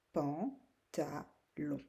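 "pantalon" in slow, separate syllables. Shown as a demonstration. Teacher asking where the sound is, syllable by syllable. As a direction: sound out "pan, ta, lon" with gaps. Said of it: "C'est où que tu entends le son".

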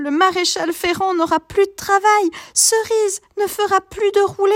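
put-on high, worried child listing woes; upset child voice. A narrator's reading. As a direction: pile up the catastrophes in a child's voice. Child lists disasters.

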